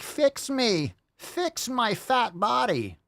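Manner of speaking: very high, drawn-out mock whine; whining patient. Mock patient begging the doctor to fix them, whining. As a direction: wail it like a demanding patient, hammed up. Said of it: "going to the doctor and, like".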